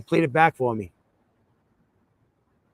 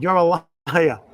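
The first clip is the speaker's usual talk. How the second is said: mildly heated accusation; retold angry accusation; 2 semitones higher, 4 dB louder, much slower than usual. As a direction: re-enact telling off a faker. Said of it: "I was swearing at him".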